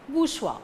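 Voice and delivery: "bourgeois" said as "boosh-wa"; pronunciation model. Shown as a demonstration. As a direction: say "bourgeois" in two syllables, silent final s. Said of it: "How to pronounce this word?".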